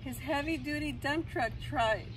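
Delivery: ordinary delivery, nothing marked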